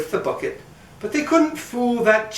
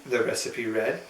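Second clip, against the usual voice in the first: ordinary delivery, nothing marked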